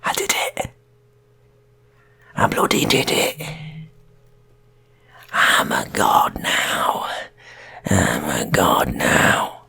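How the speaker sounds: hushed, slow whisper of triumph; awed whisper. Acted. Gunman whispers to wife.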